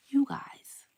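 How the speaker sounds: teasing whispered jab. Real, not acted. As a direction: hush it like a secret dig. Secretive jab at viewers.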